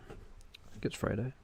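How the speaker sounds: whisper. Pretend. Whispered repeat.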